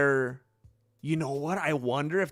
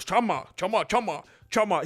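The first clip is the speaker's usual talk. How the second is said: lightly voiced Sims-like gibberish; Alex Pereira impression; 3 semitones higher than usual. Streamer mimics fighter trying to intimidate someone, lightly imitated. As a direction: repeat it like a video game character's babble. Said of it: "sounding like one of those characters from The Sims".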